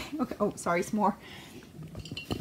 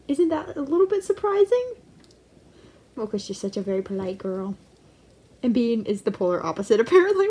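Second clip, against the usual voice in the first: ordinary talk, nothing marked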